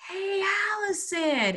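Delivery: calling out in high, drawn-out mock yell; retold yell. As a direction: imitate someone hailing a climber from afar. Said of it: "he starts yelling".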